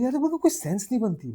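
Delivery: high, loud mock objection; exasperated protest. Pilot friend objecting to wearing uniform to a movie, exasperated. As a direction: protest it with a sudden high-pitched burst.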